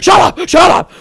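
high piercing shout; full-throated shrieked shut up; screamed. Acted. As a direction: scream her down. Peak screaming.

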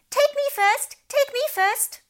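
very high eager squeals; crying out. A narrator's reading. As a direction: clamour to go first. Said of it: "began to cry out".

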